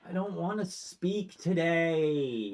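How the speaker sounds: high, slow, dragged-out moan; sulky whine.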